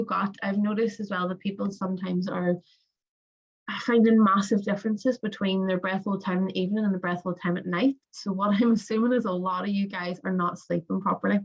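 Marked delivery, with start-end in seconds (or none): none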